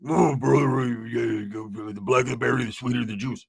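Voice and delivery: low, sleepy mumbling; groggy man murmuring from a dream; Roach's groggy voice. Retold or imitated. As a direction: talk as if half asleep, low in the throat. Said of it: "Roach would lose his groggy voice".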